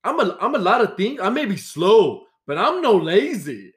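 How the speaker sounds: put-on high, indignant Brazilian-accented protest; angry Brazilian accent. Brazilian coworker denying he is lazy, indignant accented protest. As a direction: imitate an offended Brazilian coworker, voice swooping way up. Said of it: "he was angry".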